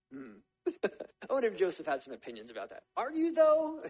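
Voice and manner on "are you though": much higher, loud sarcastic jab; mocking doubt. A narrator's reading. Joseph taunting his brothers, loud mock doubt. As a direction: needle them with disbelief.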